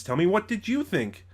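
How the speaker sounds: normal talking voice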